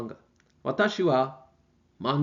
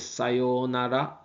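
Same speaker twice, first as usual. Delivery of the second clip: sayonara stretched out slowly; slow and clear; much slower than usual. Shown as a demonstration. Teacher saying sayonara to students, slow and clear. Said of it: "Goodbye is".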